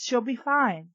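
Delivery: ordinary talk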